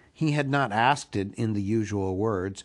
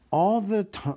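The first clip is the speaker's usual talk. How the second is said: ordinary delivery, nothing marked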